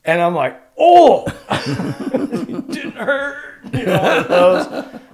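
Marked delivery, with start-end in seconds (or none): 0.8-1.3 high, loud winded gasp of a punch. Retold or imitated. Getting punched, retold. 1.5-5.0 high laughing through the words. Real, not acted. Laughing at own story.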